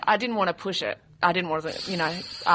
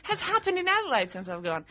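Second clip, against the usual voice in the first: shocked disbelief; 11 semitones higher than usual. Retold or imitated. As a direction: gasp at the news from home. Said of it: "I was in Singapore airport going".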